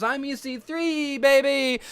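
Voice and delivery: lightly hammed-up stolen catchphrase. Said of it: "steals his gimmick and says".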